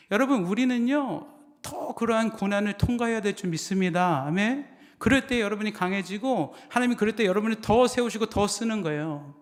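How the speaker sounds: forceful exhortation, pitched well up; impassioned preaching. Real, not acted. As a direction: drive it home to the congregation.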